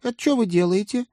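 high startled squeak; squeaky voice. A narrator's reading. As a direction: squeak it out in surprise. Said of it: "пискнула мисс Отвёртка".

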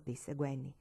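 plain reading voice

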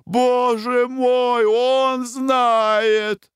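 high, slow, tearful lament; drawn-out crying howl; wailing. A narrator's reading. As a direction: stretch it out, sobbing. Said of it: "завопил Клевринг".

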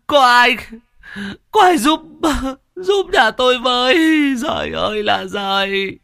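very high, loud sobbing cry for help; crying plea for help. Acted. Son on the ground begging neighbours for help, high sobbing wail. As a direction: beg for help through breaking sobs. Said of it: "mếu máo".

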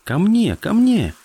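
loud high call across water. Acted. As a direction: shout to call him over. Boy calling dolphin from shore.

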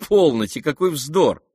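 high, loud protest; dismissive exclamation. A narrator's reading. The prince rejects talk of a challenge, exclaiming dismissively. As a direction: wave it away as absurd.

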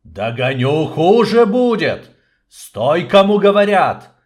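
loud threatening chase shout. Acted. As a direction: yell after him as you run. Hero chases the snake, shouting.

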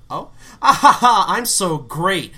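high, loud, slow smug laugh; hammy stage actor. Retold or imitated. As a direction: gloat like a vain diva. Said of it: "And she's like".

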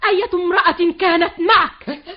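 loud heated row; angry shouting match. Acted. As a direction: shout each other down. Heated quarrel about woman. (شجار غاضب بصوت عالٍ)

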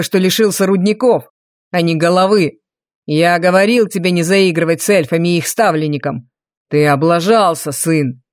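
low, scolding father voice; cold deep reprimand of the son; stern duke. Acted.

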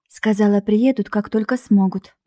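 ordinary delivery

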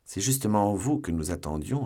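ordinary delivery, nothing marked

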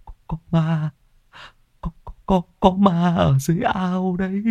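high, stuttering in shock; shaken stammer. Acted. Old man pulled from the pond stammers to the villagers in shock. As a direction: stammer it out, still shaking with fear. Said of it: "Ông lắp bắp".

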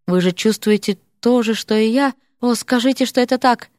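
ordinary delivery, nothing marked

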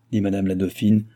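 ordinary delivery, nothing marked

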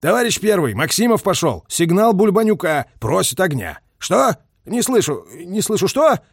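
insistent, high-pitched military report; loud phone talk. A narrator's reading. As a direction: shout into a field telephone, arguing with a superior. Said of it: "громко заговорил в трубку".

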